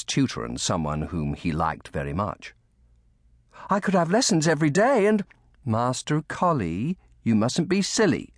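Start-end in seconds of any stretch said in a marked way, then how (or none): 3.7-5.2 put-on high, louder boy voice; brightly eager. A narrator's reading. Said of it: "Colly said brightly". 5.6-8.3 higher-pitched nursemaid's mild scolding; interrupting. A narrator's reading. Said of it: "Lucy interrupted".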